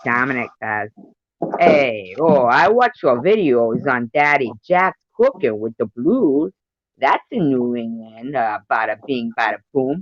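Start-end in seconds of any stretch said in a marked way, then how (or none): 1.6-10.0 put-on Italian-American New York accent; Italian New Yorker accent. Reading Dominic's comment aloud, in an Italian New Yorker accent. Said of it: "All Italian-y and New Yorker-y?".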